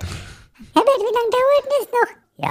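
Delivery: high falsetto wife calling from another room; nagging wife voice. Acted. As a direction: whine it like the impatient wife.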